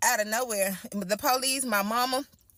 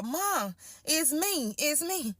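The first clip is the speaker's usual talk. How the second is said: higher, relieved and shaken; relieved cry; 5 semitones higher, slower than usual. Retold or imitated. Coming out of the bathroom to her mother, higher and relieved. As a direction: call out to mom from hiding. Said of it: "that's when I said".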